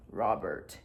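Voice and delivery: very low, hushed meme voice; deep, quiet imitation. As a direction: drop deep and soft for the name. Imitates the meme's voice.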